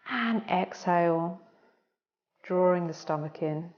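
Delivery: soft, unhurried breathing-coach voice; guided breathing cues. Acted. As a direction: talk them gently through each breath.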